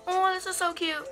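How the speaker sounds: ordinary talk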